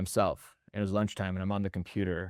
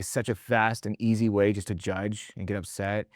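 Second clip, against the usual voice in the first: ordinary talk, nothing marked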